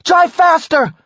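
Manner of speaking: high loud urgent shout; yelling. Acted. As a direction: scream the order at the driver. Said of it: "Jackie yelled".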